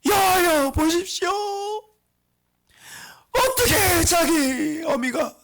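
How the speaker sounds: Jeremiah's high, drawn-out tearful wail; wailing prayer. Acted. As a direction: cry out to God as if weeping bitterly. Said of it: "펑펑 울면서". (펑펑 울며 부르짖는 기도)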